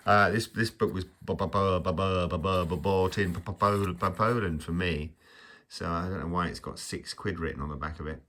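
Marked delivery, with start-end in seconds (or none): 0.5-5.1 playful stammering on B and P; mock stutter. Vlogger clowning about a book bought abroad, mock stutter. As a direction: trip repeatedly over the first sound, put on. Comic stutter after the Penguin joke.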